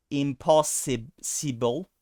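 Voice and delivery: "impossible" stretched out slowly with an I vowel; slow careful pronunciation. Shown as a demonstration. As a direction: articulate "impossible" slowly, syllable by syllable. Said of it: "make it the E as in ship".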